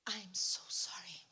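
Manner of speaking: slow whispered apology; acted weepy, whispery regret; tearful apology. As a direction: whisper it slowly, imitating a crying daughter. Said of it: "E começou a chorar".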